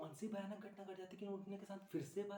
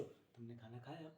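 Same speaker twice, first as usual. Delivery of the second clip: soft, low, slow question; tender, hushed check-in; concerned ex-boyfriend; 6 semitones lower, 10 dB quieter, slower than usual. Acted. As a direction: ask it gently, almost under the breath. Acts tender post-breakup check.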